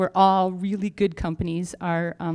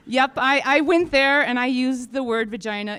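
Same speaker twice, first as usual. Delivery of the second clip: normal talking voice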